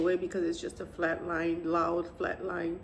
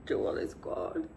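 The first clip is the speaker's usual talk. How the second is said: husband crying; 8 semitones higher than usual. Retold or imitated. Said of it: "my husband started crying and said".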